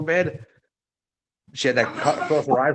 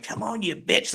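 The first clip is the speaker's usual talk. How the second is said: higher shouted dare with bitch punched; mock ring taunt; 8 semitones higher, 3 dB quieter than usual. Mickey daring Masha in the ring, mock shouted. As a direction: snap the challenge across the ring.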